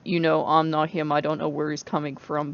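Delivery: usual speaking voice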